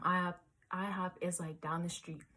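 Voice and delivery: normal talking voice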